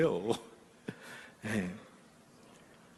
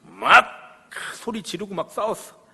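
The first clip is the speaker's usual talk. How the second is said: normal talking voice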